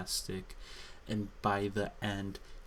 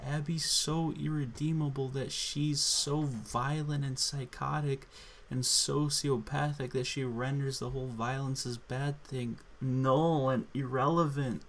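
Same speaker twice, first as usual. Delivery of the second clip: normal talking voice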